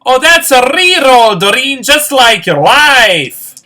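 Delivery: loud, high-pitched heckle as a hilarious Scottish woman. Retold or imitated. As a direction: holler it out like a comic Scottish wedding guest. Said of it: "Scottish and hilarious, she goes".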